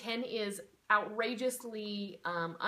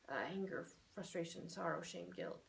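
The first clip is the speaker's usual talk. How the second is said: soft sorrowful list; 3 semitones lower, 6 dB quieter than usual. Shown as a demonstration. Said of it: "keep repeating all of these".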